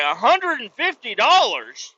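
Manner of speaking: high, drawn-out disbelief; incredulous outburst. Retold or imitated. Driver balks at a hundred and fifty bucks, incredulous. Shocked reaction to ticket price.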